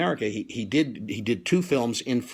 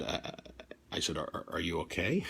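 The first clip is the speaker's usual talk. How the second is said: 1 timid; 9 semitones lower, 12 dB quieter than usual. Retold or imitated. Timid apology on phone.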